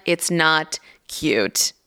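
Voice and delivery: It's not cute, slow with weight on not; slow firm denial. Retold or imitated. Mother correcting dad who calls everything cute, slow and firm. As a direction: drag it out, leaning on not. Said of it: "And I'm like".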